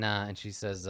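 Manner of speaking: ordinary talk, nothing marked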